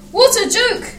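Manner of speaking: very high, gleeful mocking exclamation; chortling. A narrator's reading. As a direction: crow it with delight, pitch way up. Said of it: "he chortled".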